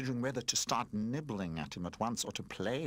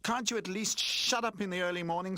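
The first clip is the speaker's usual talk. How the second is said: emphatic; 10 semitones higher, 4 dB louder than usual. Acted. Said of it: "said Roller emphatically".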